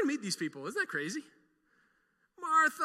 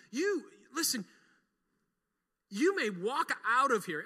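ordinary delivery

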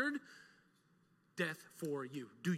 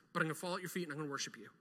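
fast, softer run; rushed quote; 3 semitones lower, 7 dB quieter, much faster than usual. Pretend. Preacher voicing Mary's devotion, fast and soft. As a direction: rattle it off quickly.

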